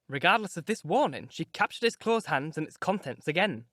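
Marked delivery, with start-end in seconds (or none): none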